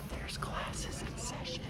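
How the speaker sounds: very soft, unvoiced aside; hushed whisper. Real, not acted. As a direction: whisper so classes aren't disturbed. Sneaking past classes in session.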